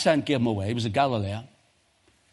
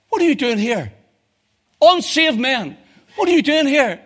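angry challenge; 11 semitones higher, 6 dB louder than usual. Retold or imitated. Paramilitaries confronting a backslider in a bar.

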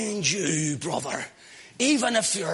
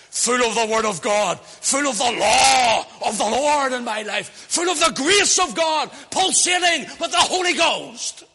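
rising sermon fervor; 3 semitones higher, 6 dB louder than usual. Acted. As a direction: preach it up until you're shouting. Preacher building to full force.

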